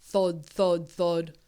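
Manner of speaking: thud, thud, thud stomped out heavily; booming footstep sounds; heavy thuds. A narrator's reading. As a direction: stomp out the giant's steps. Giant footsteps sound effect.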